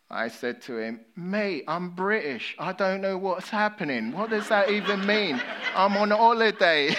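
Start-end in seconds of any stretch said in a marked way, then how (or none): 1.2-6.9 hammed-up British tourist, higher and louder; exaggerated British accent. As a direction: lay the British accent on thick, playing the confused tourist. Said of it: "amplified my British accent".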